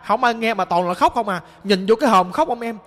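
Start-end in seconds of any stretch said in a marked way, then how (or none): none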